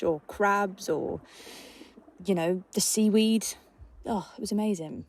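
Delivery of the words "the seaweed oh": normal talking voice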